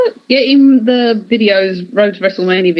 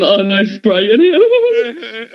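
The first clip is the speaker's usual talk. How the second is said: mocking imitation; much slower than usual. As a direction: parrot him back in a sing-song tease. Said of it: "Look at him".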